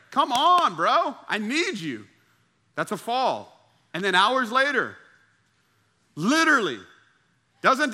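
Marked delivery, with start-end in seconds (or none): 0.1-2.1 pleading call. Retold or imitated.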